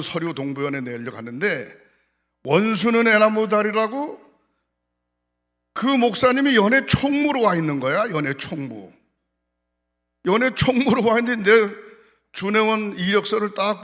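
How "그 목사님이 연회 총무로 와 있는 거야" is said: usual speaking voice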